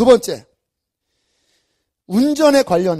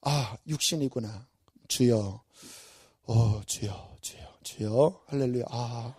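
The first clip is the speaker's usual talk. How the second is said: low, soft, slow murmured prayer; calm yourself down with a hushed plea; quiet prayer; 8 semitones lower, 9 dB quieter, slower than usual. Shown as a demonstration. Preacher demonstrating quiet prayer while driving, soft and slow. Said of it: "제 안에 막 역동하거든요".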